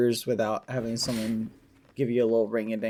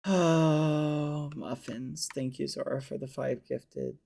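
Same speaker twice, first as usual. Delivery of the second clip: softer, deflated in-character; mopey character voice; 5 dB quieter, slower than usual. Acted. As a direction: slip back into the gloom. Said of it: "Back in character".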